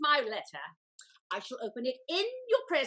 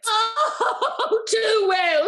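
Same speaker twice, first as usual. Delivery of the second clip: higher, louder sobbing cry; half-laughing tearful wail; tearful lament; 6 semitones higher, 10 dB louder, much slower than usual. Acted. Lady lamenting the betrayal.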